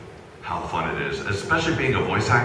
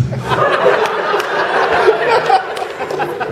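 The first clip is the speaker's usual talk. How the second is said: evil laugh; 6 dB louder than usual. Acted. Villain laugh as Vegeta.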